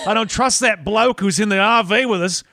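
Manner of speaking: bad Australian accent. Host plays Australians heading to the liquor store, hammed-up Australian accent. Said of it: "doing my bad Australian accent".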